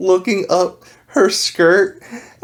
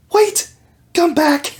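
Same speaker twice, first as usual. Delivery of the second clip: much higher, calling after it; high pleading call; 8 semitones higher, slower than usual. Retold or imitated. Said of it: "she's like".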